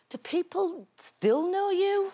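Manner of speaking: put-on high, slow teasing child voice; mocking teen voice. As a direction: play the unimpressed daughter. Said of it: "are like".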